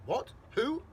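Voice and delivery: startled, rising to a high, loud yelp; shocked double take on the phone, jumping up in pitch; stunned, then squawking in disbelief; voice gone funny. A narrator's reading. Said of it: "his voice goes all funny".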